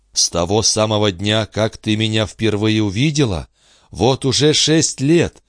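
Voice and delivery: Charny overcome with love. A narrator's reading. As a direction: pour out gratitude.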